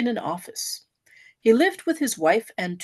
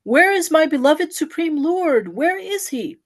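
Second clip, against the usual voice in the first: higher, slower cry of longing; crying out again; 6 semitones higher, 4 dB louder, slower than usual. A narrator's reading. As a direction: ache for the absent Lord. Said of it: "he cries again".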